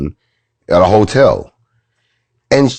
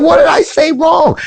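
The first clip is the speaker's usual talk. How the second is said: very high-pitched protest; mock clueless wife. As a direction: imitate the wife's indignant question, way up high. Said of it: "And she was like".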